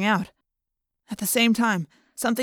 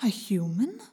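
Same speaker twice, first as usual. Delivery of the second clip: whispery telepathic voice; 7 dB quieter, slower than usual. A narrator's reading. Inner Yeerk voice, surprised.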